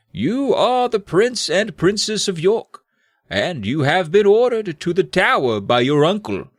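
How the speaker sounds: slow, high, theatrical elder; grand revelation in old sorcerer voice; Morgred. Acted. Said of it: "Morgred replied".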